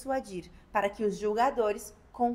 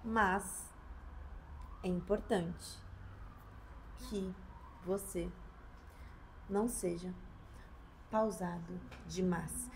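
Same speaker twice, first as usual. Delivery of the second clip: slow, softer, with long gaps between words; too slow; 6 semitones lower, 11 dB quieter, slower than usual. Shown as a demonstration. Lawyer shows students overly paused speech. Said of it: "Fale pausado".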